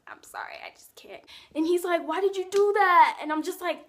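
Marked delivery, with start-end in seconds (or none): 0.1-1.2 tiny whispered refusal; hushed apology. Retold or imitated. Acted refusal, hushed take. 2.1-3.2 high, loud imitation of an upset question; mock upset boy. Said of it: "He gets all mad".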